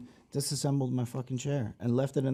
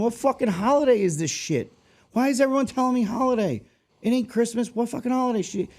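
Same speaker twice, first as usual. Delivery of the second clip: higher-pitched, exasperated mock rant; 10 semitones higher than usual. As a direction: vent to the guy at the next desk in disbelief. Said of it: "I'm like, looking at the guy next to me".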